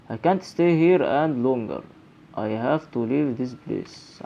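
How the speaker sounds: reading the game's lines slowly aloud; drawn-out dramatic reading of the notes; slow in-game diary read. Acted. Gamer reading the character's thoughts aloud, slow and dramatic.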